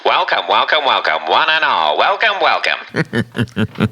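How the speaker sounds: put-on hammy old-timey radio announcer; a lightly done vintage radio host. Shown as a demonstration. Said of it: "Please do it".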